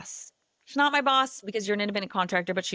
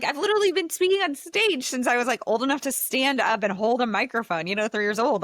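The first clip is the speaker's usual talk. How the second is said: excited boast; 6 semitones higher, 4 dB louder than usual. Retold or imitated. Retelling her own confident boast.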